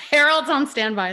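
loud, laughing through the words. Real, not acted. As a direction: giggle it out. Joking about the bleep duck.

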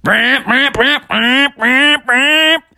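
high, buzzing dirt bike revs; revving engine noise. Shown as a demonstration. Storyteller showing how a dirt bike goes, imitated revving. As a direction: imitate throttle twists, each rising. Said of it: "it goes".

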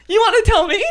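high, laughing squeaky outrage; mock indignation. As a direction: play offended, squealing. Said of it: "that shit's like".

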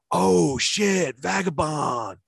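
high-pitched, thrilled retold exclamation; drawn-out, delighted mock cheer; excited reaction. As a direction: imitate a buddy hyped about lunch. Cousin's delighted reaction acted out.

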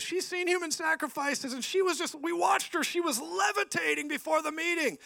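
very high, strained, frantic imitation; mock panic. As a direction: squeal it like an alarmed church worker. Mimicking frantic people bringing a witch.

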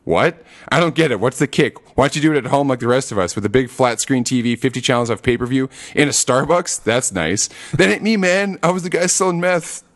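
quick, pushy lawyer patter, lightly voiced; a hint of Saul Goodman; fast-talking Saul. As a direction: rattle it off like a slick lawyer. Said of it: "he doesn't let any of them get a word in".

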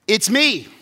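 loud, high proud reveal; mock showy. Pastor mocks a showy Jesus reveal, loud. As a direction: announce it proudly.

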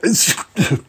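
theatrical coughing fit; hammed-up cough. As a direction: splutter a fake allergic cough. Allergic to flowers.

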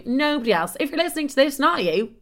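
ordinary delivery

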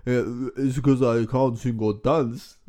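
put-on slow, heavy Transylvanian accent. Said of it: "That was worse".

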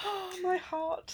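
slow, high swooning sigh; mock swoon. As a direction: swoon over it theatrically. Swooning over the show.

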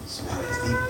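tiny, very soft mock plea; imitated hushing. Old man hushing the preacher, imitated very softly.

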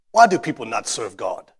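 put-on quoting David in his accent, half-joking; mock-earnest impression of David. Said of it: "Do I do the David accent okay?".

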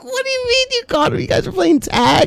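high, fast, incredulous put-on voice; mock baffled Juan. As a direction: squeal it like a baffled victim.

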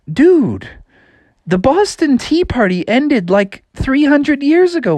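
incredulous objection. A narrator's reading. Ship crew to a fired-up frog, high and incredulous. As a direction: set him straight in disbelief. Ship's guys object to Froggy.

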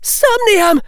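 high, loud spell cry; shouted spell. A narrator's reading. Hannah bluffing a spell at Neville, shouted high. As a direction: fling the spell out at full voice. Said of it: "Hannah shouted".

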